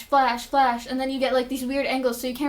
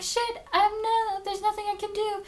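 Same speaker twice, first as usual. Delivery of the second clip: high, softer mock crying voice; imitated tearful wail; 8 semitones higher, 4 dB quieter than usual. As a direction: whine it helplessly, as if crying on the run. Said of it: "So Zoe's crying".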